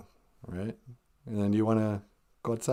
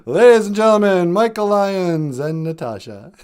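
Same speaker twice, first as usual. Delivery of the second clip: loud, fast showman intro; announcer call; 4 semitones higher, 7 dB louder than usual. Real, not acted. Radio host introducing the guests, loud and fast. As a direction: present them like an MC.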